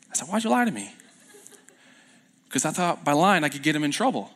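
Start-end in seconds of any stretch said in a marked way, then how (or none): 0.3-0.9 higher-pitched mock disbelief; retold baffled demand, pitched up; incredulous question. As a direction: ask it in amused disbelief. Retells confronting the lying girl.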